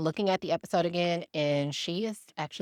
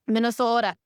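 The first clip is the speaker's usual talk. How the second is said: higher, louder "Minnesota" with a long, rounded O; mock Minnesota accent; 5 semitones higher, 4 dB louder than usual. Shown as a demonstration. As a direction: playfully lean on the Minnesota "oh" in "Minnesota". Repeats state in mock regional accent.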